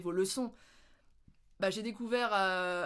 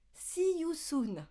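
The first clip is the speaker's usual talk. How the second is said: slow, higher, drawn-out See you soon; stiff textbook English; 6 semitones higher, much slower than usual. Shown as a demonstration. Mocking the school phrase nobody uses, slow and stiff. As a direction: say it like an old school tape. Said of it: "Personne dit ça".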